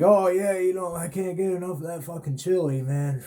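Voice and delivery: a sarcastic gush, pitched well up; mock enthusiasm. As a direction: play along like the chili is the best thing ever. Plays along with the guard.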